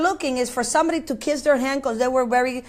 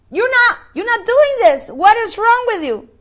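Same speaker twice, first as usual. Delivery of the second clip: higher, sharp indignant challenge; indignant rebuke; 6 semitones higher, 3 dB louder than usual. Retold or imitated. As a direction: demand an answer from hypocrites. Preacher rebuking in Yeshua's voice.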